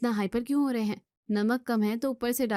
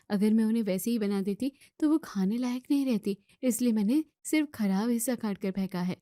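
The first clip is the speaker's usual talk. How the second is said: ordinary talk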